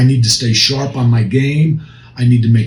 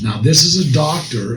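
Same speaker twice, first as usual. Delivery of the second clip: usual speaking voice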